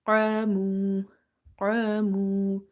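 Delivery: full, heavy qaf in Qamu, held on a level note. Shown as a demonstration. Teacher demonstrates a heavy letter, dark stretched recitation. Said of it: "Qaf is heavy".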